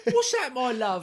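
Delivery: high-pitched, hammed-up English voice; mock English accent. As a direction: squeal it in a silly English accent.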